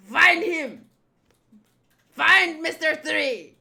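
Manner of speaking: loud, bossy orders in a mock French accent; hammed-up French-accented villain, building in force. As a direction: bark commands with a theatrical French accent. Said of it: "I'm gonna do a French accent for him".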